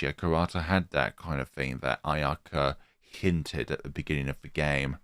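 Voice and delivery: normal talking voice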